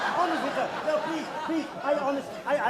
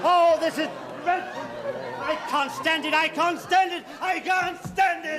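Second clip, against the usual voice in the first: building from raised to a high shouted wail; exasperated outburst; 6 semitones higher, 4 dB louder than usual. Acted. Reciter at the end of his tether with the heckler, shouting. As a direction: lose it, louder each time.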